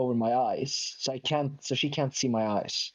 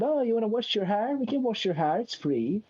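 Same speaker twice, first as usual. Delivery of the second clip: higher, sing-song pretend friendliness; pretend-casual offer; 8 semitones higher than usual. Hairdresser politely pretending to a customer, feigned friendly. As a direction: make a high, feigned-nice offer. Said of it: "and sort of pretend".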